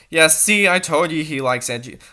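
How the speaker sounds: usual speaking voice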